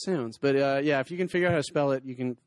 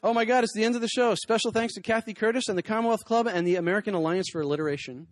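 higher and louder, rushed thanks; mock alarm; 7 semitones higher, 5 dB louder than usual. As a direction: act startled by the time. Mock panic at show's end.

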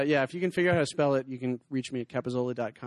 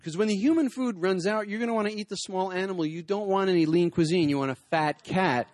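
building punchline; 5 semitones higher, 5 dB louder than usual. Acted. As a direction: punch the joke home. Punchline delivered with force.

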